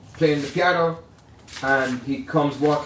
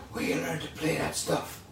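much softer hoarse half-whisper, like a damaged throat; 2 semitones higher, 9 dB quieter than usual. Retold or imitated. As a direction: rasp it out softly like a jazz legend. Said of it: "his raspy voice".

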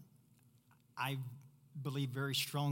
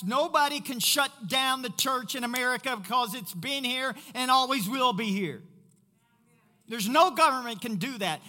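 fiery preaching; 9 semitones higher, 7 dB louder than usual. Real, not acted. Pastor rallies the church against fear, preaching louder and louder. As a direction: preach it with rising conviction to the congregation.

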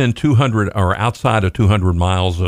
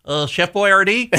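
high, hesitant fake French accent; mock French accent, unsure question; joke French accent; 13 semitones higher than usual. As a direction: offer it as bad pretend French, pitched way up. Joking attempt at speaking French.

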